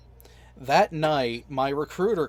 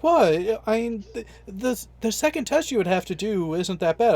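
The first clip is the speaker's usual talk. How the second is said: retold high-pitched pushback; incredulous recruiter; 6 semitones higher, 3 dB louder than usual. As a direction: imitate a recruiter balking at a refusal. Recruiter pushing back in a retold exchange.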